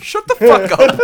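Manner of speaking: loud, high-pitched comeback; mock-angry outburst. Real, not acted. Friend teased about standing at a concert fires back, mock-angry shout. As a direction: shout it high over the laughter.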